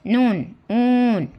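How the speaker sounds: slow, stretched "ऊन" with a long oo sound; drawn-out teacher demo. Shown as a demonstration. Teacher sounding out "ऊन" slowly for young learners. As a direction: stretch the vowel of "ऊन" for the class. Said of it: "वाव, ऊ".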